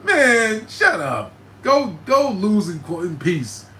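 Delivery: high, loud, disbelieving yell; incredulous outburst. Retold or imitated. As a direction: blurt it out in shocked disbelief, then slow down on the dismissal. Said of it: "I was like".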